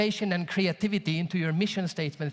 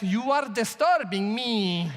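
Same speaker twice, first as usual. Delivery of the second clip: slow, drawn-out imitation; German man's objection; slower than usual. German man objecting to the swimming brother, slow and emphatic. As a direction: stretch each word out in mock indignation. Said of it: "German says".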